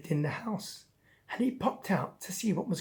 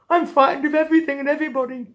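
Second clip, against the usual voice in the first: loud, high, very fast tearful blurt; choked crying; 8 semitones higher, 8 dB louder, much faster than usual. Acted. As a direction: wail it all out in a rush. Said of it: "Choking on his crying".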